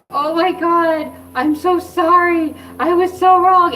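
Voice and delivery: loud, high, slow blubbering apology; mock bawling. Retelling her apology to her husband, high mock bawling. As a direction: wail it like a bawling baby, hammed up. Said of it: "I'm just bawling like a baby".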